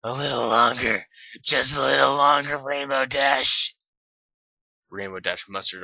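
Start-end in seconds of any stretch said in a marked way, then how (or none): 0.0-3.7 higher, louder, slow urging voice; louder slow coaxing voice; urging Twilight. Acted. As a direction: coax her on, drawn out.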